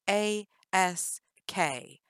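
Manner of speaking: A S K spelled letter by letter, slowly. Shown as a demonstration. Jennifer correcting Danny, spelling slowly. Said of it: "the word is ask, with a K".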